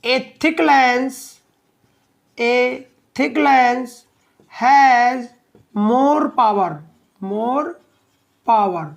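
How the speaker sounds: slow note-taking pace, word by word; dictation. Real, not acted. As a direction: stretch out each chunk and pause for writing. Dictating the fourth note point. (धीमी गति से, ठहर-ठहर कर लिखवाते हुए)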